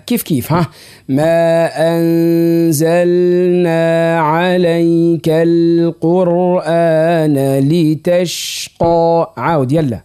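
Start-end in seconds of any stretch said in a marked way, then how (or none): 1.1-9.3 very slow measured verse, L held in anzalna; model recitation, clear lam. Shown as a demonstration. Teacher modelling the verse for his pupil, lam clear. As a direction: draw out the verse, distinct L before N. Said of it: "ندخل باللام".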